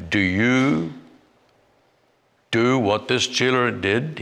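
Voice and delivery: usual speaking voice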